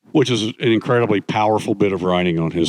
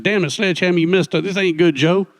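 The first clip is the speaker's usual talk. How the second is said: higher, hammed-up Louisiana grumble; mock angry Snafu; 9 semitones higher than usual. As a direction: scold him like a cranky buddy, pitched up. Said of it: "Snafu got really mad".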